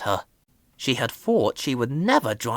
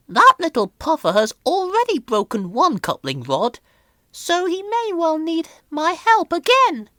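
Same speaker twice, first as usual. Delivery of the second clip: put-on high, condescending old lorry voice; haughty old steam lorry; Elizabeth; 3 dB louder than usual. A narrator's reading. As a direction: look down on the little puffer. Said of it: "she thought".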